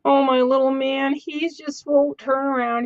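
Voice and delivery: high, slow cooing; cooing baby talk. Pretend. To the little figure that won't turn, high slow baby talk. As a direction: coo at it like a shy pet.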